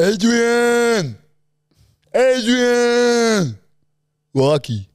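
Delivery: high, loud mushy Rocky call; loud crooked-mouth Stallone voice; Stallone impression. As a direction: bellow Adrian like Rocky. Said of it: "It's like Stallone".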